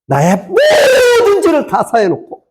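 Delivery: slow, high wailing preach; impassioned cry. Real, not acted. Preacher proclaims all sins forgiven, impassioned cry. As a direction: stretch it out in a high, anguished cry. Preacher cries out at sermon's climax.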